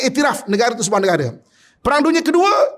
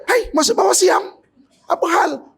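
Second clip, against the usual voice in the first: high, slow outraged quote; indignant protest; 9 semitones higher, slower than usual. Retold or imitated. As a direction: mimic the shocked cry of protest, pitched high. Voicing Patani people's protest. (protes kaget bernada tinggi dan lambat)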